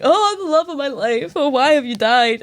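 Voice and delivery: high, melodramatic lament; mock lovelorn lament. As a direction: overact the grieving sweetheart. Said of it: "that lassie was like".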